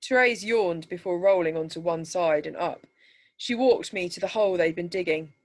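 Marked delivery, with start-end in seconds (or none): none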